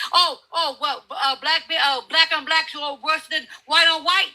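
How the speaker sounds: ordinary talk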